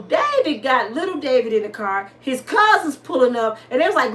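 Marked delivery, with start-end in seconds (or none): none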